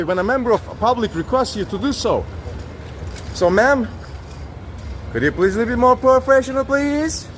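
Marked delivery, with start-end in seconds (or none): none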